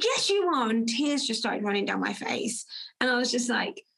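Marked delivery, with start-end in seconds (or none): 0.0-0.7 emphatic yes. Retold or imitated. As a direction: lift him up with conviction. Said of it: "I said".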